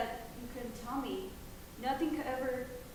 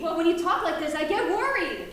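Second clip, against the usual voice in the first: louder, higher worried answer; loud defensive reply; 4 semitones higher, 12 dB louder than usual. Acted. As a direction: justify yourself shouting. Mother defends herself loudly.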